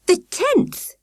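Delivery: high, urgent cry; woman finds the tents ransacked; alarmed cry. A narrator's reading. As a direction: cry out in shock. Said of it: "Missus Webb cried".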